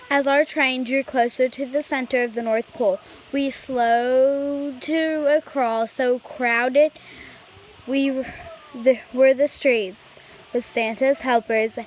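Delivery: usual speaking voice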